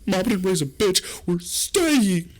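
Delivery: high, loud-ish strained falsetto; hammed-up squeaky bravado; panicked defiance. Acts panicked refusal to leave.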